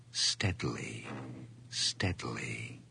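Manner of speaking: low, soft, drawn out; hushed and slow. Acted. Narrator inching the door open, low and hushed. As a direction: ease the words out like a creeping door.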